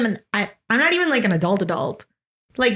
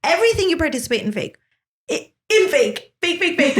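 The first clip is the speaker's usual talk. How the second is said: high, fast, giggly rant; laughing rant; 7 semitones higher than usual. Real, not acted. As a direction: rattle it off while laughing. Laughing rant about fake.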